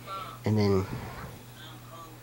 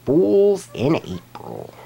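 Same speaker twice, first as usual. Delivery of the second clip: slow, higher, louder title read; title announcement; 7 semitones higher, 4 dB louder, slower than usual. Shown as a demonstration. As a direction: announce the title like a countdown host. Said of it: "announcing episode title".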